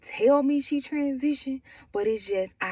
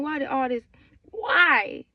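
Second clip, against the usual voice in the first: higher, louder desperate why; crying out in real anguish; 4 semitones higher, 6 dB louder, slower than usual. Real, not acted. Telling of grief, crying out why, high and loud.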